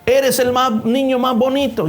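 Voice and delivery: put-on a boy calling out to the hills; child shouting compliment. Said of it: "dile alguna otra cosa".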